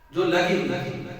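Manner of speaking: ordinary delivery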